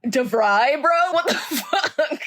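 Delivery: high-pitched, cracking up mid-phrase; incredulous and giggling, last words stretched out; laughing disbelief. Real, not acted. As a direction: barely get it out through real laughter.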